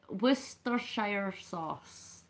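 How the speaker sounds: slow, slightly Irish-sounding delivery; accidental Irish lilt, drawn out; a bit Irish. Real, not acted. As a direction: stretch it out with a hint of Irish accent. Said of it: "Sounded a bit Irish there".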